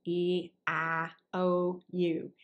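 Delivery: lazy Southern vowels, taking their time; relaxed Southern US accent. Shown as a demonstration. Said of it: "in South, we are a little bit lazier".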